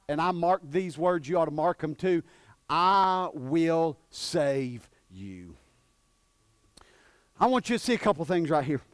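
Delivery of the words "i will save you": very slow, low, drawn-out proclamation; solemn promise. Real, not acted. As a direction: hold each word out with great weight. Solemn reading of God's promise.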